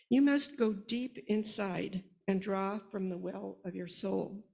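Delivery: measured, heartfelt poem reading; reciting a poem to an audience about firstborn children; poem recitation. Acted. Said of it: "a simple poem called Firstborn".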